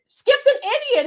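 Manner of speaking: emphatic high repeat. Shown as a demonstration. Streamer echoes a viewer's words back, high and emphatic. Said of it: "you said it".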